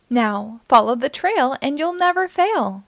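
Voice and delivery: ordinary delivery, nothing marked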